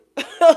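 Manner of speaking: sudden shrill burst of laughter; a big high laugh at the absurdity; loud high laugh. Real, not acted. Laughing at the smug person's teasing.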